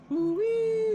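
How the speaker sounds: high, loud whooping hail; high yell. Acted. Game master voicing a hail over the PA, high yell. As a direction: whoop it out at the top of your range. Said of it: "And it yells".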